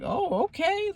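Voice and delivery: taken aback and quiet; surprised, hushed reply to a friend; stunned okay. Retold or imitated.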